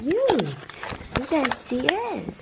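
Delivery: slow high gushing; excited. Real, not acted. Owner showing viewers the kitten's belly, excited. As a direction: stretch it out, thrilled.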